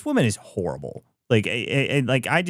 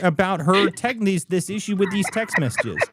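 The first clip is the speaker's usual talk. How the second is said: mock outrage; 10 semitones higher than usual. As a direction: sneer it like an offended elite. Mocking the politician's outrage.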